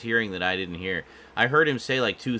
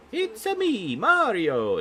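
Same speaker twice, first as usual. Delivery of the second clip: very high, bouncy Mario voice; cartoonish Italian plumber squeal; high-pitched Italian Mario; 7 dB louder, slower than usual. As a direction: chirp it out like the game Mario. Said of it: "he had this more kinda high-pitched voice".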